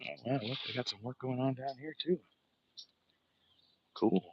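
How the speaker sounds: ordinary talk, nothing marked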